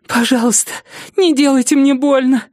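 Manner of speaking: high-pitched pleading victim; pleading frightened woman. Acted. Captive woman begs attacker not to hurt her, high pleading.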